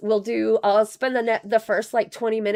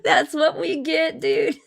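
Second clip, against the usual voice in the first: laughing through the words; cracking up while talking; 6 semitones higher, slower than usual. Real, not acted. Laughing at a funny clip.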